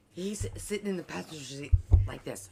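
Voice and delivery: normal talking voice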